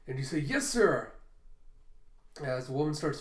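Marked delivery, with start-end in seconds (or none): 0.4-1.1 eager loud reply. Acted. Subordinate answering boss Kaido.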